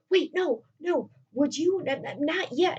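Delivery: high, stammered mock panic; nervous protest. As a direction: act out stalling with cold feet. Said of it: "now he's getting nervous".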